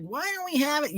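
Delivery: put-on high, whining child voice; whiny kid. Streamer imitating his childhood self complaining, whiny. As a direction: mimic himself as a bratty kid. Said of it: "That was me as a kid".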